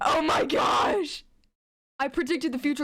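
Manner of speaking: stretched-out gleeful yell; excited shout. Real, not acted. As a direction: shout it in delighted disbelief. Shocked at predicting twist.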